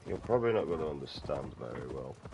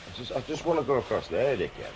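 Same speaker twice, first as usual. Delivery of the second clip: exasperated; 8 semitones higher, 4 dB louder than usual. Real, not acted. Frustrated at game character.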